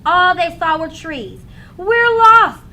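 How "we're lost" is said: louder, higher alarm; worried kid. A narrator's reading. Lost boy telling his brother, worried and loud. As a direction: sound the alarm.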